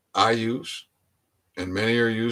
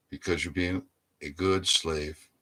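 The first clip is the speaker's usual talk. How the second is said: ordinary talk, nothing marked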